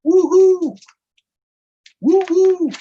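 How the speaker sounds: very high, loud woo-hoo call; whistling cheer. Acted. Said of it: "the boys whistle".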